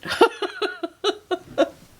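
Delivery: high bright burst of laughter; embarrassed laugh. Real, not acted. Painter laughing at her own whispering, amused giggle. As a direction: laugh at yourself, caught out.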